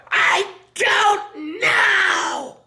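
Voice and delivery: frantic screaming. Retold or imitated. Person at home shrieking at Larry, fed up. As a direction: stretch it into a desperate shriek.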